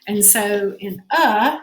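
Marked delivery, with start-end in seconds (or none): 1.1-1.6 drawn-out schwa; schwa sound. Shown as a demonstration. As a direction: hold the lax "uh". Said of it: "It's that schwa sound".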